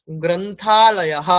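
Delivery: গ্রন্থালয়ः very slow with a breathy -ah ending; Sanskrit visarga. Shown as a demonstration. Teacher giving the Sanskrit word for library, very slow. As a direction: draw it out, end on a breath. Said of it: "next".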